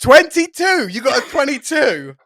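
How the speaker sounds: loud, high disbelieving yelp; amazed. Real, not acted. GM shouting in disbelief at the player's roll.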